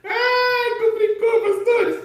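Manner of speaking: very high shrieked "ai, complicou"; comic falsetto squeal. Acted. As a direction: squeal it in mock panic. Comic reaction to pastors.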